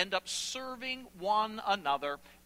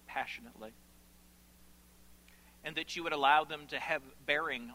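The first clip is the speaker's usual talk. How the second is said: lower, soft-ending pleas with long pauses; earnest prayer; 5 semitones lower, 3 dB quieter than usual. Real, not acted. Preacher asking the Father for grace, hushed earnest prayer. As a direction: pray quietly and sincerely aloud.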